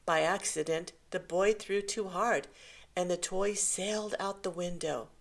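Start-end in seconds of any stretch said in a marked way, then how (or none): none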